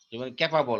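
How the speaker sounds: ক্যাপাবল with a flat Bengali "ka" and "bol"; ক্যাপাবল as Bengali learners say it; common Bengali mispronunciation. Shown as a demonstration. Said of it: "আমরা বলি ক্যাপাবল".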